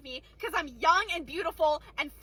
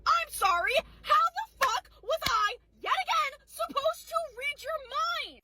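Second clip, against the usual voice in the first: higher, slow, drawn-out disbelief; exasperated; 6 semitones higher, slower than usual. Real, not acted. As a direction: stretch it out in total exasperation. Exasperated rant at customer.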